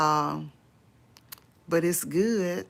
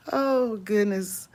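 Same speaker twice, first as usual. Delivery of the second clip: ordinary talk, nothing marked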